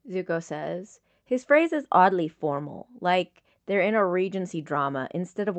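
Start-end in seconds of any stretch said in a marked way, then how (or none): none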